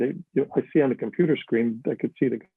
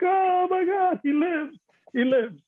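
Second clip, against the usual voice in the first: much higher, stunned disbelief; amazed; slower than usual. Retold or imitated. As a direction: gasp it out like seeing a ghost. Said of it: "they were like".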